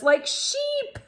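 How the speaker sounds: chuckling as she reads; amused read with a laugh at the end. Real, not acted. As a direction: giggle at the funny image. Amused by herding dog.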